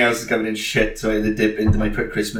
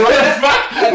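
amazed; 7 dB louder, slower than usual. Retold or imitated. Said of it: "We were like".

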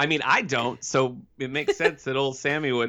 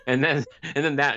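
ordinary talk

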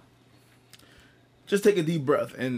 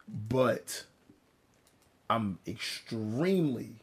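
ordinary talk, nothing marked

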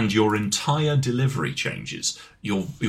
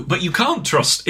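ordinary talk

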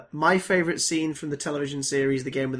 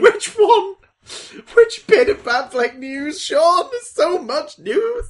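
laughing mock exasperation; 12 semitones higher, 8 dB louder, slower than usual.

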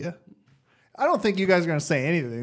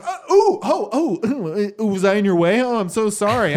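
high, flustered mock oops; sarcastic apology; 3 semitones higher than usual. Shopper caught blocking the lane, sarcastic high-pitched apology. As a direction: imitate an oblivious shopper's fake apology. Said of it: "I'm being sarcastic".

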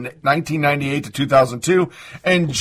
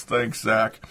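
usual speaking voice

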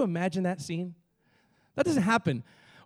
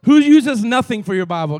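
calling out; 3 semitones higher, 11 dB louder than usual. Acted. Calling out to congregation.

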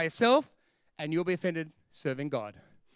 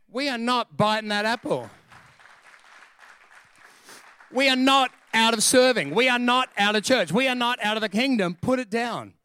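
loud, higher-pitched preacher's insistence; emphatic refusal; 4 semitones higher, 7 dB louder than usual. Acted. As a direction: put your foot down, forcefully. Acted firm plea to a spouse.

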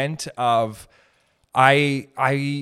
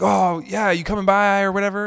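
higher, louder, overeager friend; imitating eager friend; 7 semitones higher, 6 dB louder than usual. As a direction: mimic the pumped-up birthday friend.

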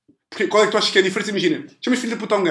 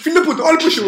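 mock cheer; 11 semitones higher, 5 dB louder, faster than usual. Acted insult quote.

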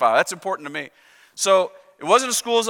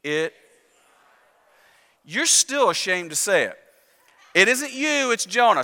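ordinary talk, nothing marked

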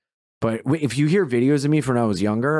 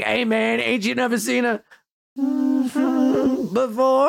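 high-pitched train beggar impression; mock beggar; 11 semitones higher than usual. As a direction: put on a high voice with a censoring gap. Voices the beggar again.

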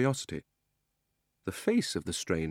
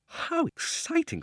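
much higher, slow, breathless delight; thrilled girl; slower than usual. Acted. Polly thrilled by Diggory's talk of a mad uncle, high and slow. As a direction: draw it out up high in wonder.

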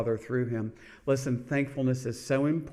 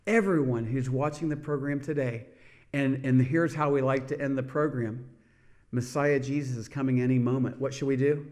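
ordinary talk

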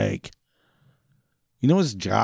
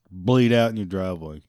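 ordinary talk, nothing marked

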